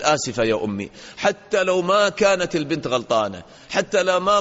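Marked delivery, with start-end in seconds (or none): 0.0-0.9 lower, head-bowed humble sorry; humble apology. Shown as a demonstration. Daughter humbly apologising to her mother, lower and meek. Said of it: "البنت هكذا تنزل رأسها، تتواضع لأمها".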